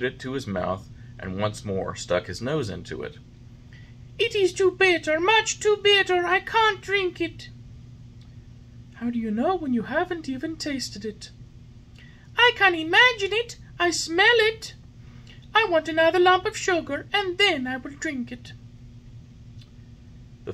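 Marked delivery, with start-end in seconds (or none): 4.2-7.5 put-on high, loud whiny boy voice; stubborn puppet. A narrator's reading. Puppet refusing the fairy's medicine. 8.9-11.3 high, gentle fairy voice; fairy, patient. A narrator's reading. Fairy patiently coaxing the puppet. 12.4-18.5 put-on high, loud whiny boy voice; stubborn puppet. A narrator's reading. Puppet refusing the fairy's medicine.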